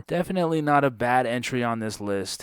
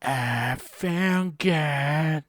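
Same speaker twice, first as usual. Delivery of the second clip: slow, breathy rock singer voice; 4 semitones higher, much slower than usual. Retold or imitated. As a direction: draw it out like a soulful frontman. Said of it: "Here's my [name] impression".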